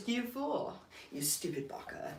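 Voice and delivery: mock anime insult. I love saying that.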